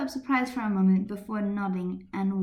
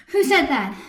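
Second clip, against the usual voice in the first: panting; 5 semitones higher, 9 dB louder than usual. Acted. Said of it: "She said in short gasps".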